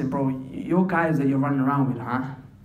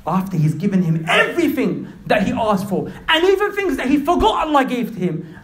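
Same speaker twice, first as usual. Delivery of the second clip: loud, high-pitched sermon delivery; ringing, heated exclamations; impassioned preaching; 10 semitones higher, 5 dB louder than usual. Real, not acted. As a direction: preach at full force, amazed. Preaches at full force.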